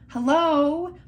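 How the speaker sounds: high, loud incredulous hello; loud mock disbelief; exasperated hello. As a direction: call it out like she's lost her mind. Exasperated retort to sister-in-law.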